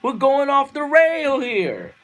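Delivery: high, loud yelled warning; screaming. Retold or imitated. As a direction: scream it up at the people in charge. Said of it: "I'm here screaming to the powers that be".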